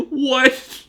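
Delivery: high, loud startled outburst; startled what. Real, not acted. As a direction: jolt in surprise. Narrator startled.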